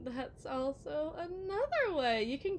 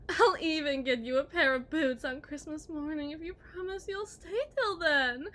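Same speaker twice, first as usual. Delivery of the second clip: mock tearful plea; 2 semitones higher, 8 dB louder than usual. Wife mock pleading through laughter.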